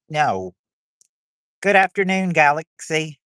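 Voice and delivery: ordinary talk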